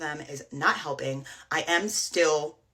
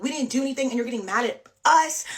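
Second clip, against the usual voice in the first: higher, louder imitation of his protest; retold defensive yell, raised but held back; mock screaming back; 6 semitones higher, 5 dB louder, faster than usual. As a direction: imitate him yelling an accusation back. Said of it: "He's screaming back at me".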